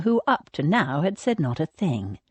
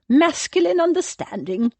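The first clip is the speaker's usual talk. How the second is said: scathing echo; 10 semitones higher than usual. A narrator's reading. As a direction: throw his words back at him. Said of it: "scathing glare".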